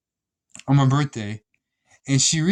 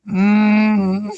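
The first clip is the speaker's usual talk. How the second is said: cringing groan; 6 semitones higher, 7 dB louder than usual. Retold or imitated. Cringe noise.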